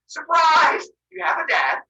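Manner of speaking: high, loud ta-da delivery; spring it like a party surprise; mock surprise announcement. Mother Earth springs the news.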